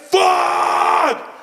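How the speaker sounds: high, strained reenacted yelp of agony; screaming in pain. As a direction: scream it like a fresh injury. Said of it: "'Cause you were screaming like".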